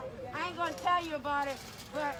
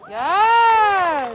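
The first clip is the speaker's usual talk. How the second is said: shouted yes; 17 dB louder than usual. Real, not acted. Rally roll call, crowd affirms.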